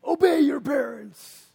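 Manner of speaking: high, loud, slow mock command; hammed-up preachy parent; mock stern parent. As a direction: bark the verse like a bossy parent. Parent weaponizing Bible verse.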